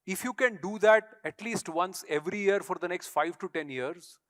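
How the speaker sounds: ordinary talk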